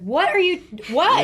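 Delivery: loud, high, exasperated question; retold baffled shouting. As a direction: call out as if wearing headphones. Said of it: "trying to talk to me with my headphones in".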